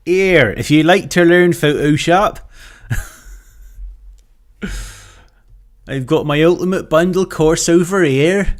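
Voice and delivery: loud, high hammed-up West Country sales pitch; a broad West Country huckster selling his course; over-the-top West Country advert voice; West Country accent. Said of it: "West Country accent version".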